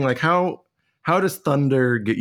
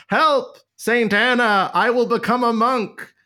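high-pitched, mock-desperate cry; terrified cry for help; 10 semitones higher, slower than usual. Martin Luther crying out in a thunderstorm, mock-terrified. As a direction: cry out a frightened vow, hammed up. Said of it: "he cried out".

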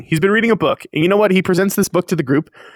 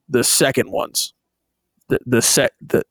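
lower, halting, pointed hint; hinting Hawks; 4 semitones lower, 3 dB quieter than usual. Retold or imitated. Hawks slips Endeavor code words, low pointed hint. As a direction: drop the voice and nudge with each repeat.

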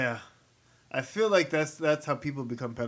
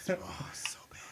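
whispering; 3 semitones higher, 12 dB quieter, slower than usual. Real, not acted. Disgusted aside about the combination.

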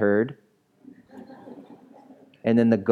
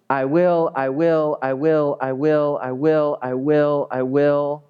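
"I will" repeated in an even, raised beat; insistent repetition; 3 semitones higher than usual. Shown as a demonstration. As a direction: hammer "I will" over and over on the same tune. Hammers the repeated Bible phrase.

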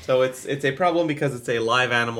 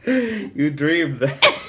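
very slow, laughing it out; 2 semitones higher, 3 dB louder, much slower than usual. Real, not acted. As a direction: squeeze the words out between laughs. Husband denying through laughter.

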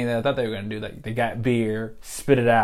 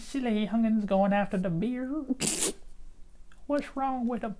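put-on old lady voice; 13 semitones higher than usual. Mocking old Southern grump.

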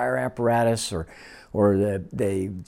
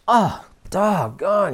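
exasperated; 8 semitones higher, much slower than usual. Retold or imitated. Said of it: "he was exasperated about something".

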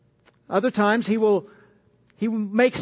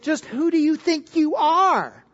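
sneering, higher "Just who do you think you are?"; "are" pitched up in mock contempt; cynical; 6 semitones higher than usual. Shown as a demonstration. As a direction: throw the question back with scorn. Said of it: "Said most cynically".

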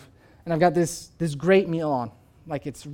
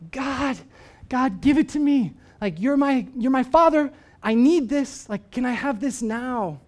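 desperate plea; 6 semitones higher, 3 dB louder than usual. Retold or imitated. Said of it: "we've been like".

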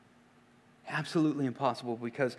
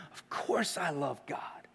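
whispered self-assurance; 8 dB quieter than usual. Retold or imitated. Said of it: "the scribe likely thought".

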